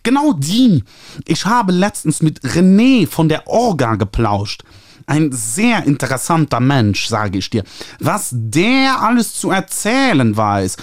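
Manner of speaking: high, nasal, gushing publisher voice. A narrator's reading. Publisher Ernie calling the author, high nasal gushing. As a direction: trumpet it through your nose like an eager publisher. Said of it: "anstrengendes Näseln".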